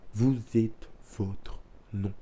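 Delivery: Vous êtes votre non, said slowly and softly, every word drawn out; a quiet, deliberate first take of the motto. Real, not acted. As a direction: hush it down and let the words sink in.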